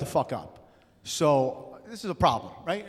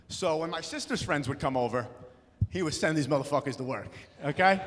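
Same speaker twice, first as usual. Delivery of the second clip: usual speaking voice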